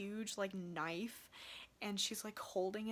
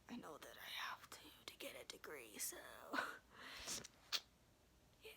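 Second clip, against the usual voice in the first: soft, lower reluctant whisper; whispered aside; 5 semitones lower, 10 dB quieter, faster than usual. Real, not acted. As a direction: mutter it under your breath, resigned. Reluctant about going back to college.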